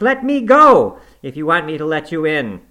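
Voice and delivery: terrified dreamer shouting at the ghost to release him; frantic shout. Acted. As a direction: yell to be let go.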